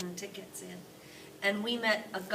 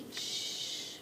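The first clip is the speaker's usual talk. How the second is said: whispered shh. A narrator's reading. Grandpa Joe hushing Charlie, a whispered shh. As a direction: hush the sleeping grandparents. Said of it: "whispered Grandpa Joe".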